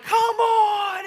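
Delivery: high exasperated cry; frustrated. Retold or imitated. Player groans at the actor, hammed-up frustration. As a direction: throw up your hands in mock frustration. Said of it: "you get frustrated and you're like".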